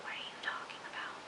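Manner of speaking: barely audible breathy whisper; stunned whisper. Retold or imitated. Viewer hearing Mike tell Mona he loves her, stunned whisper. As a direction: whisper it in confused disbelief. Reacting to Mike saying love.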